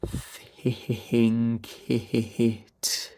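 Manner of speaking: "Think it" drawn out with breath pulses; stretched, stuttering-breath "Think it"; pulsed vowels. Shown as a demonstration. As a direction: bounce air through each vowel of "Think it". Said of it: "then feed through that vowel".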